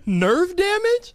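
high, slow disbelieving echo; incredulous. Real, not acted. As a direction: repeat it in disbelief.

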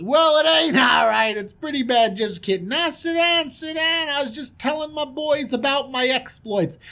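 high-pitched swaggering big shot; boastful prince. Acted. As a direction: play the prince showing off. Prince banters and introduces himself.

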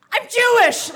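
put-on high, blurted child's confession; sudden high-pitched mock-panicked outburst; falling apart. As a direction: imitate a kid cracking under pressure. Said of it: "Like, I fell apart".

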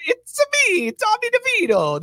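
high, bright mock tough guy; Tommy DeVito impression. Host shows what the impression should be, high and mocking. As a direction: toss off a quick DeVito boast. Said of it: "All I needed you to do".

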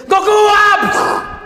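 brief, high, loud demo scream; one-second high burst of a scream; short death scream. Shown as a demonstration. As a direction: cry out for Goku and cut it off fast. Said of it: "el grito es".

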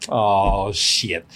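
low, deadpan stoic hero; emotionless guarded hero. Acted. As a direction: play a hero showing no emotion, pitched well down. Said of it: "guarded hero who shows no emotion".

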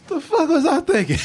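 wheezing with laughter; can barely talk for laughing; laughing through words. Real, not acted. Laughing at own past take.

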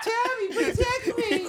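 high laughing plea; high mock plea. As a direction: squeal it out while laughing. Playing scared closeted self.

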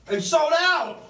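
high, loud surprised cry; shocked outburst. Real, not acted. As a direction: blurt it out in shock. Surprise at sold-out show.

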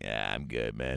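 very low, soft brush-off; low, half-hearted pretend brush-off; mock refusal. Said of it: "if I was like".

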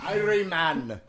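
soft, poor Jamaican accent. Shown as a demonstration. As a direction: try a Jamaican greeting, badly.

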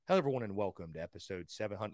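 low, quick, soft show intro; subdued early-days host. Retold or imitated. Host imitating his own early podcast intros, subdued and low. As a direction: rattle off a low-key welcome, pitch and volume dropped. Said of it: "My voice has evolved".

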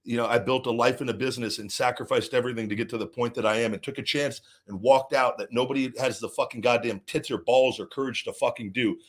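ordinary delivery

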